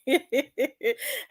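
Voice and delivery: short amused laugh; a quick laugh at her own jab; chuckling at the roast; real laugh. Real, not acted.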